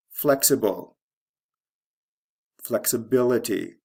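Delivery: FLEXible, then flexiBILity; shifting stress. Shown as a demonstration. Teacher reading the pair, stress moving from first to third syllable in Flexibility. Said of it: "First syllable to third syllable".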